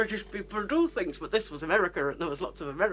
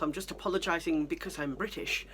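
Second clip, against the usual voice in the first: usual speaking voice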